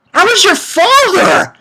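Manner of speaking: high, exclaimed protest; outraged disbelief. Acted. Reviewer protesting Minerva's reaction, high incredulous outburst. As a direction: cry it out in mock outrage.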